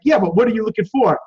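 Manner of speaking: a lightly voiced pushy store clerk; pushy salesperson. Clerk pressing a browsing customer, mildly pushy. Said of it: "like a pushy salesperson at your".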